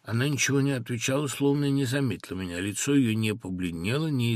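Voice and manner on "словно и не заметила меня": usual speaking voice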